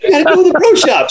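high, fast urging; urgent order. Retold or imitated. Pro sending his caddy running to the pro shop, high and fast. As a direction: hurry him off to the shop.

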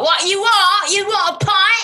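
put-on high sing-song Cockney accent; Cockney pub worker. Acting a shift at the local pub, mock Cockney. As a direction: call out orders in hammed-up Cockney. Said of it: "a shift at the local pub".

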